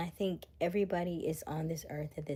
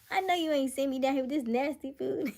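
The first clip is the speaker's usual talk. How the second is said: high, loud mock outrage; comic indignant complaint; 9 semitones higher, 6 dB louder than usual. Jesus complaining to God about nasty food, comic outrage. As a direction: complain upward in playful disbelief.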